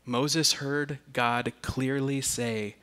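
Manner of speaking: usual speaking voice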